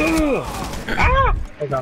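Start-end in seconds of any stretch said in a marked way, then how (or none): 1.0-1.4 loud high yelp; pained yelp. Real, not acted. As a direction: yelp sharply as you're hit. Shot in game.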